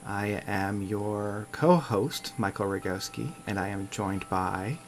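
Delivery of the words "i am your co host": usual speaking voice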